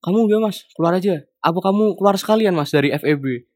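high-pitched mock scolding; imitated angry dismissal. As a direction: play a fed-up lecturer throwing someone out. Lecturer throws student out.

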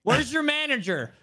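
high, loud, drawn-out demand; entitled customer. Acted. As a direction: play a mock outraged customer, stretching it out. Acts entitled customer demanding the manager.

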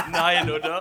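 loud laughing no; cracking up in disbelief. Real, not acted. Friend shown losing again.

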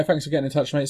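ordinary talk, nothing marked

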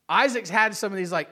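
ordinary talk, nothing marked

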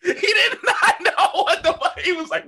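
high, laughing through the words. Real, not acted. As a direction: crack up while telling it. Laughing retelling the funny moment.

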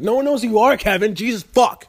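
high mock outburst; hammed-up shout at a friend; mock yell.

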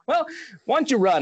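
high, light dad voice; dad's voice. Retold or imitated. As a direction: raise the pitch for dad. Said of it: "And my dad's like".

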